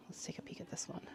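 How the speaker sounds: hushed whisper; breathy whisper, barely audible. Real, not acted. Quiet aside while browsing.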